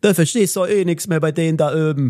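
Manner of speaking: put-on overdone East German accent with ö for the vowels. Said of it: "mit so einem ostdeutschen Akzent sprechen". (gespielter ostdeutscher Akzent)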